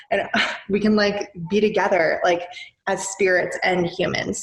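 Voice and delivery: usual speaking voice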